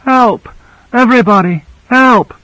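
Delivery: very high cry for help; narrated shrill call, pitched far up; shrill answer. A narrator's reading. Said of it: "She answered shrilly".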